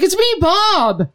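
very slow, high reveal; big reveal. Retold or imitated. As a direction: stretch it out high like a grand unmasking. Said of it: "He's like".

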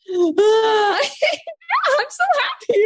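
high-pitched mock toddler squeal; hammed-up burst of toddler glee; ecstatic toddler. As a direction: shriek it like an overjoyed two-year-old. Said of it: "my two and a half year old, he will be like".

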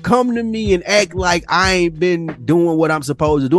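ordinary talk, nothing marked

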